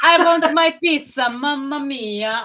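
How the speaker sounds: high, hammed-up Italian voice; mock Italian accent. Mocking Italian-loving player.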